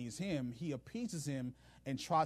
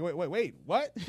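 rising, louder double take; startled disbelief; 2 semitones higher, 5 dB louder than usual. Retold or imitated. Ahab hearing the offer, startled and louder. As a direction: caught off guard, demand it again.

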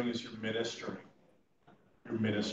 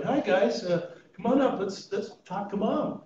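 higher, upbeat greeting, slower at the end; bright welcoming call; 6 semitones higher than usual. Retold or imitated. Chaplain cheerfully calling the adult children to the bedside. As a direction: cheerfully beckon the shy family over.

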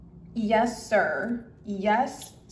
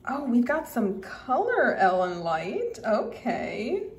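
usual speaking voice

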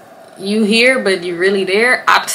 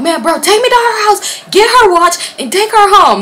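high, loud impatient order; mock exasperation; 12 semitones higher, 5 dB louder than usual. Fed-up friend orders the drive home, high mock exasperation. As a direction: act out a friend who has had enough.

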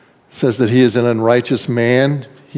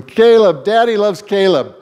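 retold holler; 7 semitones higher than usual. Father calling across the room to his son, fond playful holler. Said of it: "he used to holler across the room".

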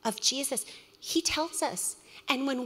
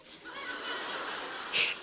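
laughing; 4 dB quieter than usual. Real, not acted. Mother retelling son's reply.

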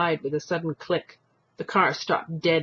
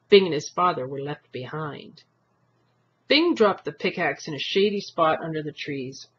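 ordinary delivery, nothing marked

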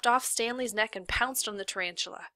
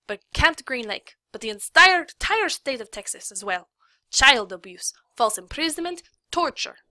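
angry threat; 4 semitones higher, 8 dB louder than usual. Acted. Lawyer threatens warden.

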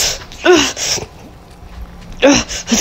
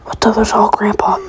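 ordinary talk

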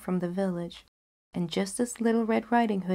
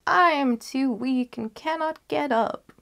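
put-on higher, louder frail old woman; frail grandmother; 7 semitones higher, 4 dB louder than usual. Grandmother explaining she is too weak, higher. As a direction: call out weakly but higher. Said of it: "called out the grandmother".

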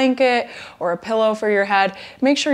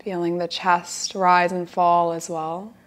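guided relaxation. Acted. Yoga teacher guiding students lying down to relax, guided relaxation. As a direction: settle them gently into the breath, unhurried.

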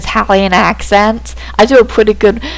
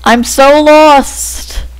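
drawn-out, raised exasperation; exasperated; 5 semitones higher, much slower than usual. Real, not acted. Lost player despairing at the game, exasperated. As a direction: stretch the words out slowly, pitched up.

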